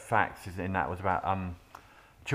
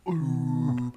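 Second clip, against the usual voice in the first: sinister voice; 3 semitones higher, slower than usual. Acted. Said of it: "That's quite sinister as well".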